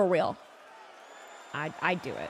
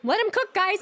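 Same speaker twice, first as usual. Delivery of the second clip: excited shout; 9 semitones higher, 3 dB louder than usual. Real, not acted. High excited call.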